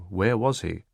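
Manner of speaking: ordinary delivery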